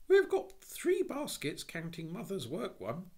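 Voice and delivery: high, eager and suddenly hopeful; brightening. A narrator's reading. Jane offering the baskets to her siblings, brightening. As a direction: perk up with a bright idea.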